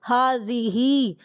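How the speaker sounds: حاضی ہی said slowly, haa-zi-hi; slow model phrase. Shown as a demonstration. Teacher repeats حاضی ہی for students, slowly. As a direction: spell حاضی ہی out slowly in sound. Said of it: "مؤنث کے لیے حاضی ہی".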